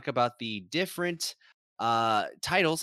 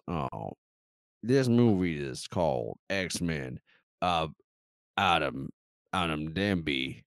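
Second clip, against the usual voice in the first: put-on lower, drawn-out Georgian accent; 6 semitones lower than usual. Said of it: "gotta talk like this".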